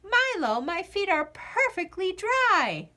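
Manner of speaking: put-on girl announcing to her dog, pleased; announced. A narrator's reading. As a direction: declare it like big news. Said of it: "she announced".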